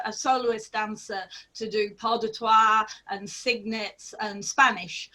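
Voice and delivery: ordinary talk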